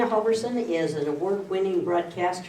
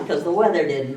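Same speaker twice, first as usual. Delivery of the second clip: louder, chuckled through; laughing; 8 dB louder than usual. Real, not acted. Host joking that the weather was not the reason, laughing. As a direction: deliver the punchline mid-laugh. Host's follow-up joke, laughing.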